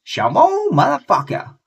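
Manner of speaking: very high, loud squeal of a catchphrase. Retold or imitated. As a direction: yelp it like a pop-star caricature. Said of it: "his catchphrase was".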